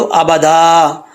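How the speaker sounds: अबादा with its final आ held long; stopping form. Shown as a demonstration. Teacher shows how to pause here, long final vowel. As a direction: stop on अबादा, stretching the end without an n. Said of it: "वक्फ करेंगे तो".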